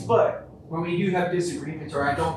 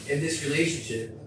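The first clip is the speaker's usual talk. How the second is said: ordinary delivery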